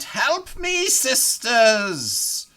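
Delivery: high, loud hag's cry for help; screaming out. Acted. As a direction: scream for your sisters across the battlefield. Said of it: "screaming out".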